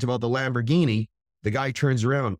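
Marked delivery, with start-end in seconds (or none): none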